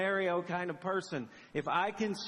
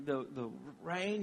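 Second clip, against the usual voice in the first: normal talking voice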